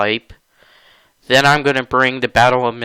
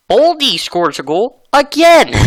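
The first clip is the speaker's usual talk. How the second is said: higher, louder exasperated call; fed up with yet another goal; indignant play-by-play of the rival's goal; annoyed; 11 semitones higher, 5 dB louder than usual. Real, not acted. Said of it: "I'm getting angry with this".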